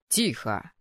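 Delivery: low, unraised command from the dean; calling to order. A narrator's reading. Dean calls laughing boys to order, low and controlled. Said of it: "призвал парней к порядку".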